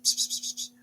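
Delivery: unvoiced psst hisses; psst whisper. Dad calling the kids over secretly, whispered psst. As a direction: call them over on the hush. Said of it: "whispering to them".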